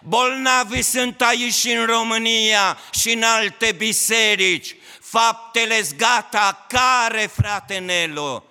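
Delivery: preaching at full force. Acted. Pastor rousing the church to finish the work, preaching at full force. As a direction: thunder it over the whole congregation.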